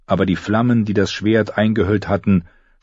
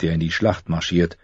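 usual speaking voice

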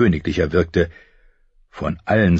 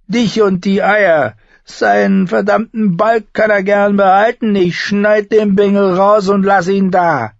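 high, loud, unhinged raving; crazed threatening rant; 6 dB louder than usual. A narrator's reading. Brother threatening his pregnant sister, loud high crazed rant. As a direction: rant like a mad prince, forcing every demand.

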